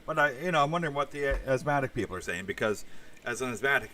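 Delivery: ordinary talk, nothing marked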